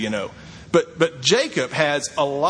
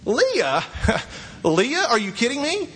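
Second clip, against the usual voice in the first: disbelief; 7 semitones higher than usual. Acted. Jacob discovers his bride is Leah.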